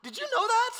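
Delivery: high, loud incredulous question; hammed-up disbelief; mock amazement. Preacher asking congregation about peppers, high mock amazement. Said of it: "asks congregation, mock amazed".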